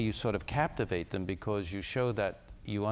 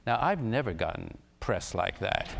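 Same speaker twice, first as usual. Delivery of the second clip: normal talking voice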